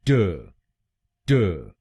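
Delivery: ट said as a short "tuh"; short ट with no long vowel; the middle syllable as a clipped ट; second syllable. Shown as a demonstration. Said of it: "हम बोलेंगे".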